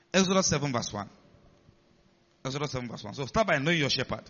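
normal talking voice

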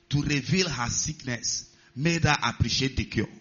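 normal talking voice

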